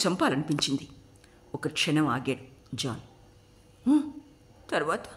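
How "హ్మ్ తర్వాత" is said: a touch choked up. A narrator's reading. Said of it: "గద్గద స్వరంతో అడిగింది శబరి".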